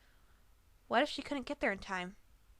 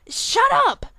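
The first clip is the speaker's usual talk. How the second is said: much higher, far louder desperate scream; desperate yell; 16 dB louder than usual. A narrator's reading. Pegasus cornered by griffin's accusations, desperate yell. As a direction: scream to make it stop. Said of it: "Rainbow Dash yelled desperately".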